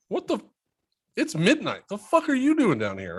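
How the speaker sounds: high, startled midnight shock. Retold or imitated. Dad to his sleepwalking daughter, high startled shock. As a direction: jolt up in alarm, voice jumping high. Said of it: "I'm like".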